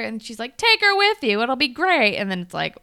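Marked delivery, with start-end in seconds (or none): 0.6-2.2 high, loud, chirpy mom imitation; mock breezy mom. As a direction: breezily overrule your son, imitating a mom. Mom brushes off son's objection.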